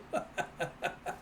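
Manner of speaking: soft breathy laugh dying away; real giggle. Real, not acted. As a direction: giggle until out of air. Narrator giggles at picture.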